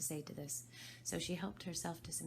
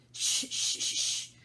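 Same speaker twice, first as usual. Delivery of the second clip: loud hissed shushing; hushing; 11 dB louder, slower than usual. Acted. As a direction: shush her sharply. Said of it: "the Hatter and the March Hare went".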